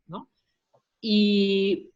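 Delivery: ordinary delivery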